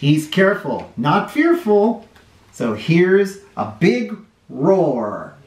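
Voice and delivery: put-on lively storybook read-aloud for little kids; sing-song picture book reading; reading to children. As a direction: read it like story time on the rug.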